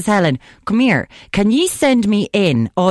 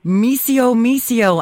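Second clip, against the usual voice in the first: higher, coaxing missio; lightly sung missio call; Italian cat call; 6 semitones higher, much slower than usual. Shown as a demonstration. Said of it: "how you get an Italian cat to follow you".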